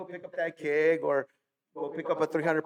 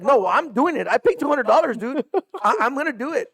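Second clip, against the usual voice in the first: stubborn insistence; 8 semitones higher, 5 dB louder than usual. Retold or imitated. Insists on racing anyway.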